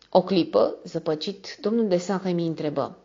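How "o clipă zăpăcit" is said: usual speaking voice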